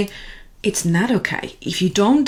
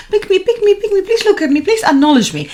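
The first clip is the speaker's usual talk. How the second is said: put-on high, pleading child voice, much higher; begging child; 11 semitones higher, 3 dB louder, faster than usual. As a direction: emotions begging like kids.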